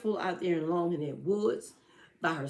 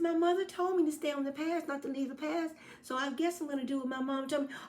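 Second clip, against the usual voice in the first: put-on high, obedient little-girl voice; 9 semitones higher, faster than usual. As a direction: recite mom's rules like a good child. Girl refuses wolf.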